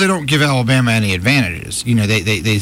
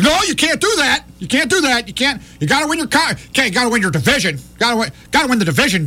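mock protest, high-pitched and rapid; Mark May; 10 semitones higher, faster than usual. Co-host mimicking a TV pundit's objection, high-pitched protest. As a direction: imitate an exasperated pundit objecting.

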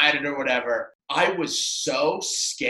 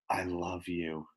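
imitating a soft, low love confession; mock tender murmur; 9 semitones lower, 13 dB quieter, slower than usual. Girl leans in to the storyteller, mock intimate. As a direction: drop low and very soft, as if leaning in close. Voicing her leaning in.

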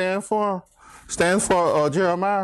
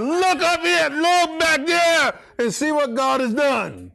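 higher, slowed proclamation; Adam proclaiming; 7 semitones higher, slower than usual. Retold or imitated. Adam calling everyone to see what God made. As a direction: call them to look, stretching it out. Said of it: "He said".

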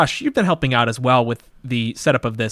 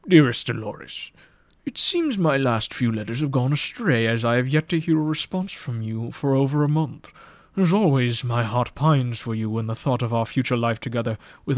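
put-on older gentleman letter voice. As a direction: lean into an old suitor's flowery tone. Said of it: "The narrative voice of this letter tells me that it was an older gentleman".

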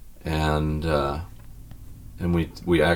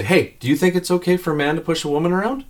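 higher, raised voice calling down the hallway; calling out a question to shame him; retold yell; 8 semitones higher, faster than usual. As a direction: call down to the maid, mock indignant. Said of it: "I yell down to the maid".

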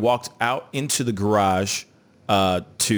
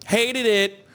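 high, campy catchphrase delivery; hammed-up high comic voice; mock disdain; 12 semitones higher than usual. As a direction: repeat it as a high-pitched comic bit. Repeats it as a jokey reference.

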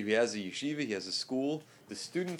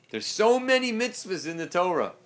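mock complaining, higher and louder; imitated complaint; 7 semitones higher, 8 dB louder than usual. Teacher quoting people who come to the rabbi, mock complaining. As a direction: raise it into an exasperated mock grievance. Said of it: "people come and they complain".

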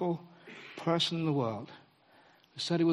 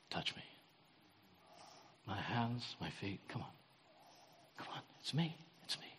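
very soft, hushed coaxing; barely above a breath, much softer; tender invitation; 8 semitones lower, 16 dB quieter than usual. Retold or imitated. Jesus tenderly inviting his followers, very soft. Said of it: "And Jesus says".

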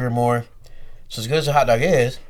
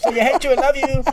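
higher, lightly put-on voice; mock quote; 12 semitones higher than usual. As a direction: parrot the teasing style. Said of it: "mock quote".

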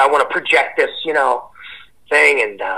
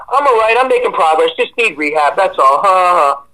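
higher, louder show of being fine; blowing it off; 4 semitones higher, 5 dB louder than usual. Retold or imitated. Patient hiding how bad it is from a visiting buddy, breezily blowing it off. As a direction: brush off concern with a forced breezy laugh. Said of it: "blowing it off".

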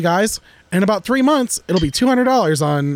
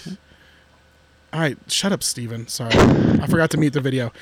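ordinary talk, nothing marked

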